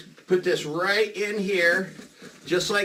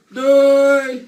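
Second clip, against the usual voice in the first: high, loud, held-out goodbye; loud calling; 7 semitones higher, 9 dB louder than usual. Acted. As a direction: call out as if to someone hard of hearing. Calling goodbye to earless drawing.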